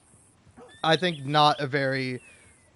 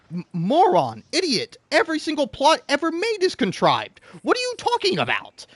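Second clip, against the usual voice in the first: much higher, incredulous outburst at a moron; mock exasperated rant; 10 semitones higher than usual. Ranting at critics who call the plot contrived, exasperated and high. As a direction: scoff it up high like you can't believe anyone said it.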